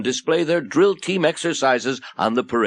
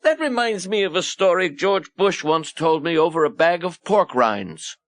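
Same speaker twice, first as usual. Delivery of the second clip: higher-pitched storytelling uncle; raised voice, slowing at the end; reminiscing Uncle Newt; 6 semitones higher than usual. Acted. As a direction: start an anecdote for the children. Newt begins reminiscing to orphans.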